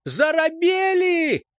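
high, drawn-out taunting yell; jeering shout. A narrator's reading. Enemy on the heights taunts the squad, jeering shout. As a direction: hurl it down at them, stretched out.

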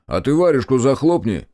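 loud, biting shut-up with the weight on варежку; rude comeback. Acted. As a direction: fire it straight back at him. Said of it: "вернул ему Олег". (грубо и громко, с ударением на «варежку»)

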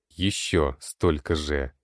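ordinary delivery

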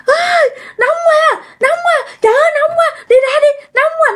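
very high wailing cries, reenacted; retold screaming. Possessed mother crying out from the heat, retold high scream. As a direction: scream as if burning, in a high wail. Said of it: "mẹ lại la làng lên".

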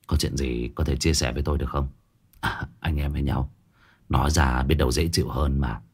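low, soft male character voice; coaxing colleague. Acted. Man offers Huy a listening ear, low and coaxing. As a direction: lean in low and quiet, inviting confidence.

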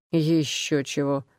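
a slow, low little growl from a dog; light growl. A narrator's reading. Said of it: "слегка рыкнул Бонни".

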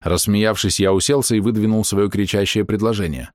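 ordinary delivery

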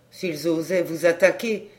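ordinary delivery

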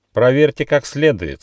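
stern kangaroo in charge; strict order. A narrator's reading. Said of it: "строго сказала кенгуру".

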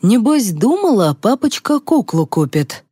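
high, singsong taunt that drops at the end; scornful, swooping mockery; mocking. A narrator's reading. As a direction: jeer at the child's trust with biting sarcasm. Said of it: "с издёвкой произнёс Сеня".

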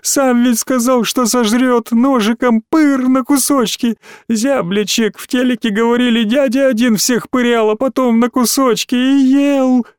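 put-on high, wailing child's voice, building; sobbing plea. A narrator's reading. Small boy begs friend at locked door, sobbing. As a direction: sob it out in rising terror. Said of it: "бил и рыдал".

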